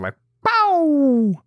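high, loud imitated pop of a cork. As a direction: pop it like a champagne cork going off.

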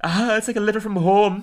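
high, touched Scottish brogue; cartoon Scot, pitched right up; Willie, Scottish accent. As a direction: gush in a put-on Scottish accent. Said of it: "I'm Scottish".

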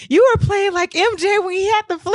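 very high, excited teammate imitation; high-pitched mock awe; hyped praise. Teammates' amazed praise acted out.

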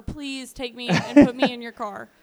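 loud, hammed-up begging; mock plea, pushed out loud; desperate plea. Beaten Night Stalker begging police to arrest him, desperate loud plea. Acted plea during a beating.

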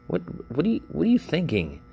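venting exasperated questions; exasperated disbelief. Real, not acted. Venting at the movie's choices.

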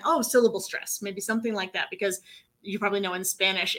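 normal talking voice

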